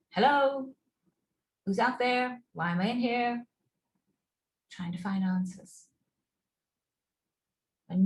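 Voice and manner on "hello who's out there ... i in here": higher, louder call into the dark; a lost person calling hello into an empty room. Pretend. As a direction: shout for someone out there. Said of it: "So you start to shout".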